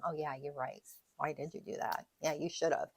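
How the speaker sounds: low, soft mock agreement with self-blame; mock judging friend. Bad friend answering someone grieving, quietly judgmental. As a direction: play the unsupportive friend, dropped low and quiet. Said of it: "you would never tell them".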